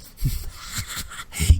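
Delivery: breathy, voiceless villainess cackle; wicked laugh. Acted. Spore woman laughs at those firing on her, wicked breathy laugh. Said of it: "She goes".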